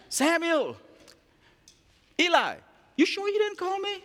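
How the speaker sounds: put-on high boyish voice, puzzled; boy Samuel. Young Samuel asking Eli if he called. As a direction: ask like a sleepy child.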